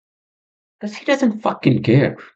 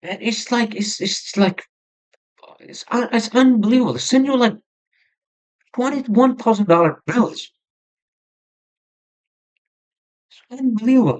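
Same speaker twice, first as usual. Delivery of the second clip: high-pitched, exasperated; real frustration pushing the pitch right up; frustrated disbelief; 9 semitones higher than usual. Real, not acted. Podcaster aghast at huge bills sent to him, exasperated. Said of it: "I'm just showing my frustration".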